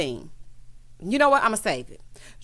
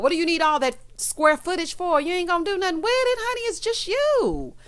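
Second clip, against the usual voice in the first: incredulous scolding; 8 semitones higher than usual. Real, not acted. Vlogger chiding Kenya for wanting a bigger hotel room, incredulous. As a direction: climb higher and stretch the last words.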